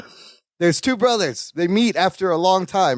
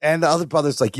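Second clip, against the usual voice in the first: ordinary talk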